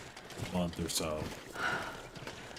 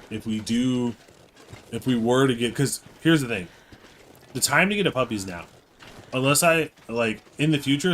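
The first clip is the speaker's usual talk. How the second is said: ordinary delivery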